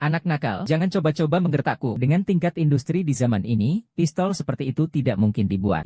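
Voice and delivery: ordinary talk, nothing marked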